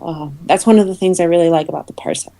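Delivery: usual speaking voice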